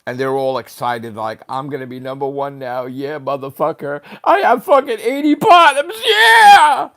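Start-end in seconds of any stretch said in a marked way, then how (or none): 1.5-6.9 builds from plain mutter to a loud high whooping cheer; mock gloating cheer. Vlogger mocking players celebrating at number one, rising to a whoop. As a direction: gloat like a winner, ending in a whoop.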